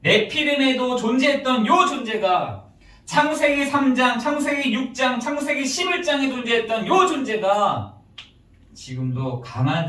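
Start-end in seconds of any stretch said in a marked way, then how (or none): 0.0-8.0 forceful preaching. Acted. Preacher listing Genesis chapters to the congregation, forceful preaching. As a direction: preach it out loud, drawing out each phrase.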